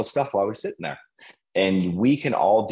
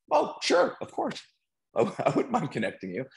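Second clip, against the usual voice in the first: much higher, bright put-on voice with a laugh; mock happy yes; 10 semitones higher than usual. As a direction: play the obliging friend, laughing. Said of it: "he'll ask".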